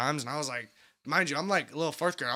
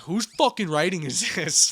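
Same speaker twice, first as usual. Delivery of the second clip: slow, louder teacher's demand; mock angry teacher; 2 semitones higher, 5 dB louder, slower than usual. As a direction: play the irritated teacher.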